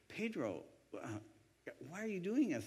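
soft, high, halting disbelief; replayed bewildered protest; baffled gratitude. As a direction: act out being overwhelmed by kindness. Acts baffled gratitude to waiter.

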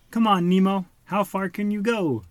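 high, loud taunting dare; challenging. A narrator's reading. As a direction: egg him on loudly. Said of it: "Tad challenged".

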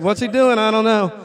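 baffled exclamation; bewildered. Retold or imitated. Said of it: "they were just like".

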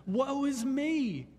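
high, drawn-out cry of woe; stricken woe. Acted. Preacher voicing Isaiah before God's holiness, stricken. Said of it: "stricken with grief and absolute inadequacy".

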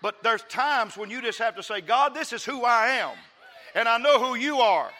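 loud, high, building to a peak; full-force preaching. Real, not acted. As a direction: preach it with rising, impassioned fervor.

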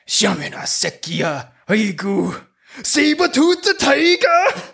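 slow, then rising to a laughing yell; grumpy old Korean man. Acted. Old Korean Ranger cursing as he calls his zord, slow then yelling. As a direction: curse in Korean then shout the beast name. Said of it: "He's gonna say, like, bad words in Korean".

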